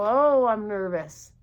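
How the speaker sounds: usual speaking voice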